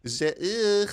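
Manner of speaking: high exaggerated French noise; mock French accent. Shown as a demonstration. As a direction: parody French, pitched right up. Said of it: "I can also speak French".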